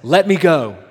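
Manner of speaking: a loud, strained cry to be let go; loud urgent plea. Acted. Preacher voicing the angel wrestling Jacob, loud urgent plea. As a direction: cry out, straining to break free.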